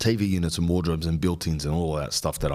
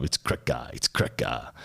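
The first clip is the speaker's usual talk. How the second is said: much lower, softer "It's cracker"; low mock catchphrase; 9 semitones lower, 3 dB quieter than usual. Host closing a sponsor plug, a low mock catchphrase. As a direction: drop the voice low and soft for a mock slogan. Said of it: "satirizing that stupid word".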